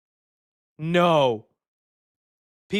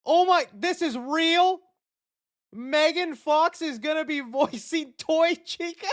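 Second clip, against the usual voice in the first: can't believe it's real; stunned disbelief; 11 semitones higher than usual. Real, not acted. Streamer reacting to casting reveal, high disbelief.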